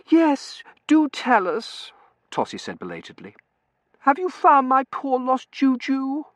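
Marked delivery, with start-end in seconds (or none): 0.1-1.9 very high, slow, loud plea; high-pitched simpering urging; gushing girl. A narrator's reading. 4.0-6.2 slow, very high simper; drawn-out, high-pitched pleading; plaintive girlish gush. A narrator's reading. Girl laments her lost pet.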